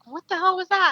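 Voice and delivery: high, incredulous outburst; startled disbelief. Retold or imitated. Hunter reacting as the arrow bounces off the gator, shocked. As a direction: blurt it out in shock.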